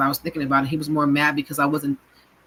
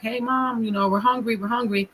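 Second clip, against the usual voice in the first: higher, lightly voiced children calling to Mom; kids asking for food; 7 semitones higher than usual. Children calling their mother for dinner, high and pleading. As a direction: whine up high like hungry little kids.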